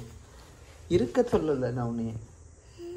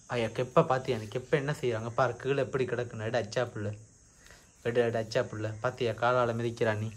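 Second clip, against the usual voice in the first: soft coaxing; 5 semitones lower, 4 dB quieter than usual. Real, not acted. Parent gently coaxing the toddler to pick up the cap, soft and low. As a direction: lower and softer, gently coaxing the little one.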